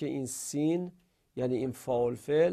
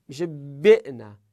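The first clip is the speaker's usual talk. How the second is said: ordinary delivery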